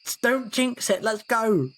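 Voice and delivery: high, urgent plea to leave; nervous urging. Acted. Alex urging D through the portal nervously. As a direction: push them to move now.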